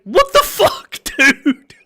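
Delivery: high, laughing what the fuck; incredulous laugh breaking through the words; laughing disbelief. Real, not acted. Disbelieving reaction to chat message.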